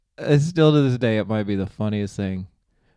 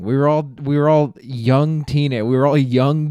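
normal talking voice

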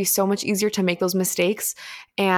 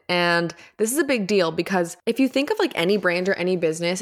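usual speaking voice

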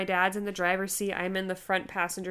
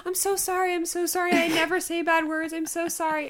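high-pitched, sweet repeated apologizing; retold pleading niceness; profuse apology; 9 semitones higher than usual. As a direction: apologize earnestly to nurses. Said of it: "And I would apologize".

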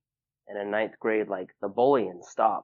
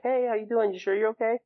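high imitation of caring friends; retold check-in, pitched far up; friendly concern; 13 semitones higher, 4 dB louder than usual. Friends checking at day's end, imitated high and concerned. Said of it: "they're just like".

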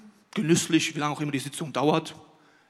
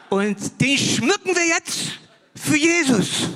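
higher, louder, slow, dopey drawl; goofy husband voice; 8 semitones higher, 6 dB louder, much slower than usual. Retold or imitated. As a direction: play the husband proudly explaining nonsense. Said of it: "Und dann kommt der um die Ecke". (höher, lauter und langsam, naiv-stolzer Ehemann)

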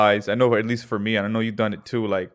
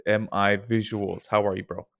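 usual speaking voice

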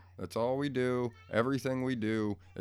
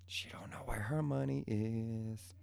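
slow whispered aside; slow, whispered aside about his daughter; 5 dB quieter than usual. Real, not acted. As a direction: whisper it so she won't hear.

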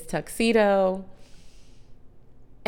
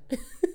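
short laugh; 9 semitones higher, 5 dB quieter than usual. Real, not acted. Laughs after mock line.

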